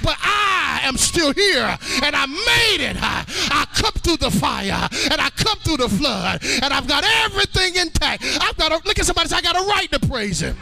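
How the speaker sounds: driving sermon cadence with gasped "uh" breaks; high, urgent testimony to a congregation; full-force preaching. Real, not acted. Preacher rousing the congregation to testify, full-force preaching.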